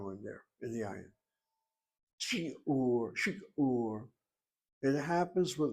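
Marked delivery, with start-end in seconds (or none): 2.2-4.0 "Shi'ur" broken in two, shi, then ur; syllabified pronunciation. Shown as a demonstration. Teacher demonstrating the syllable break, careful pronunciation. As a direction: detach "shi" from "ur" with a small catch between. Models potentially closed first syllable.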